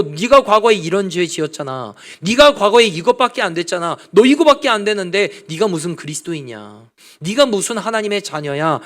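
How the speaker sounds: Satan digging up our past and mocking us; mocking accuser. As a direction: sneer at the believer's past. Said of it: "악한 사단 마귀는 늘 우리의 과거를 들춥니다".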